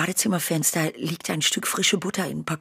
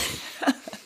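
quiet teasing laugh; soft mocking chuckle; 4 dB quieter than usual. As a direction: chuckle at his watering eyes. Mother laughs at his tears. (leises Kichern)

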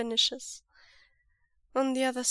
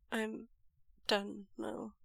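softer, faltering and small; small, broken voice; 6 dB quieter, slower than usual. Acted. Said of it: "voice small and broken".

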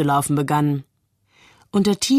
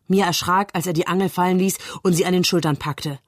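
usual speaking voice

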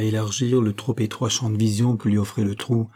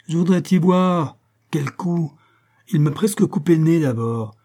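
high-pitched, aggrieved complaint; indignant protest; 6 semitones higher, 3 dB louder than usual. Acted. Acted protest of the injured brute. (protestation indignée, voix haut perchée)

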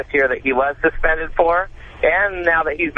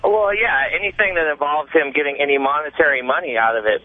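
ordinary talk, nothing marked

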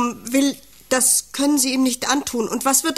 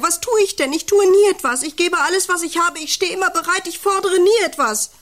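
high and loud, pleading outburst; rising wail; 7 semitones higher, 5 dB louder than usual. Acted. Farmer's wife cries out her complaints, loud and high. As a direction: pour out the grievance, voice climbing.

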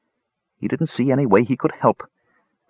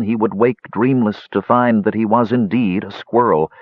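usual speaking voice

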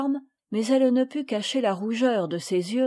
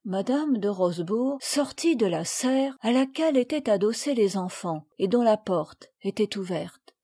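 plain reading voice

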